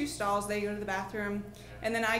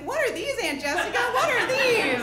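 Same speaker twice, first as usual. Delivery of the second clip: put-on high, loud child voice, insistent; curious little nieces; 13 semitones higher, 10 dB louder than usual. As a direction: call out like a child demanding answers. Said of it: "my sister's kids are, like, holding up".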